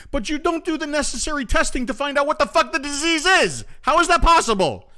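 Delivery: host venting fury at officials who skip testing; angry outburst. Real, not acted. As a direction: let the anger burst out at the missing testing. Ranting about missing disease testing.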